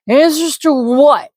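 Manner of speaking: put-on high, slow, drunken snarl; bitter drunk sneering at the wizard; drunk man's retort. Drunk man snaps back.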